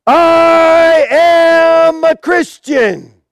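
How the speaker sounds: long drawn-out, high shout; acted martyr's defiant proclamation; bold declaration. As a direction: cry it out to a whole arena, stretching the words. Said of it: "stands up and he says".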